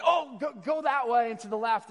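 high, loud, eager directions; mock-excited helpful kid. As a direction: play an eager kid urgently pointing the way. Kids eagerly giving directions.

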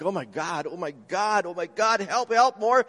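building from mild to loud, high panicked pleading; desperate cry to God. Retold or imitated. Reporter fleeing the eruption, crying out, building to loud high panic. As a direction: retell the reporter's panic, rising to a near shout. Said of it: "sobbed and panted and spoke directly to God".

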